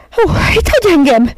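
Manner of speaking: very high, loud shriek. A narrator's reading. Miss Lark shrieking as the dogs leave. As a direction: shriek in panic. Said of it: "sikoltott fel".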